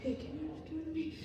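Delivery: hushed, quick, secretive aside; covert whisper. Retold or imitated. Dad secretly asking the delivery guy, quick hushed whisper. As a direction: murmur so no one overhears.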